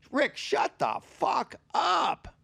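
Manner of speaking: high, slow, drawn-out mock shout; exasperated outburst. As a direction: stretch it out like a fed-up heckler. Imagined exasperated audience reaction to story.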